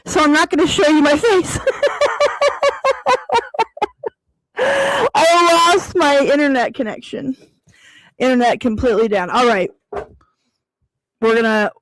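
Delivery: speaking without dentures; no teeth. Real, not acted. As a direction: talk with your teeth out. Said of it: "took out my teeth".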